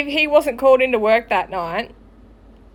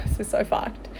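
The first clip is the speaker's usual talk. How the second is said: muttered aside; 3 semitones lower, 9 dB quieter than usual. Real, not acted. Aside while telling the mess.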